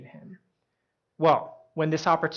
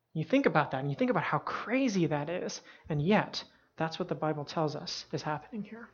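usual speaking voice

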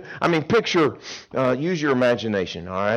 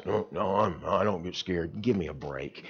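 low, soft mock tough guy; deadpan denial; macho guy; 7 semitones lower, 10 dB quieter than usual. Preacher imitating guys denying fear. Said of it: "You know, guys".

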